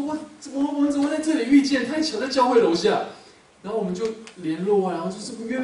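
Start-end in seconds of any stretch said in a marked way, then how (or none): 0.0-3.1 high, loud, tumbling over the words; excited amazement. Retold or imitated. Pastor delighted to run into classmate below church, excited. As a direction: stammer with delight at the coincidence. Unexpected meeting in restaurant.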